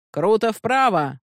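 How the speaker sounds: captain calling out, high and drawn out; slow, high-pitched command; shouted helm order. A narrator's reading. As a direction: sing out the order across the deck, slowly. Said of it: "прокричал капитан".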